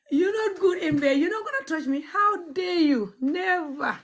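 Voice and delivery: high, laughing refusal; mock indignation. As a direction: turn him away in hammed-up outrage. Acted rejection of a bad lover.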